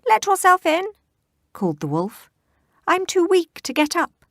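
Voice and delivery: high, loud granny impression; wolf pretends to be grandma; fake granny voice.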